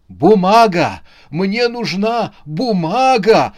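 loud, high, frantic plea; desperate, insistent demand; heated urgency. A narrator's reading. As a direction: plead heatedly, with voice pushed up and loud. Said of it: "с жаром зашептал он".

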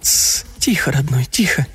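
hushed comforting whisper; soothing whisper. Acted. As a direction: whisper to calm him. Woman hushing him.

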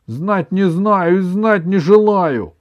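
high, loud, emphatic refusal; cutting refusal. A narrator's reading. Grandmother refusing to know any marching song, cutting refusal. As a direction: refuse outright, spelling it out slowly. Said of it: "отрезала бабушка".

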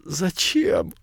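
wheezing, gasping out the word; hoarse gasp, no breath left. Acted. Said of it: "На последнем издыхании он прохрипел".

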